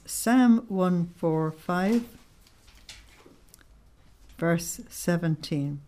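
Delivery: slow, spaced-out reference for note-taking; slow dictation of the verse number; dictating slowly. Shown as a demonstration. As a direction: spell out the citation so they can write it down.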